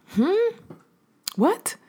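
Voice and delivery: high, bewildered hmm and what; stunned hmm. Retold or imitated. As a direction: mimic being caught off guard. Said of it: "I was literally like".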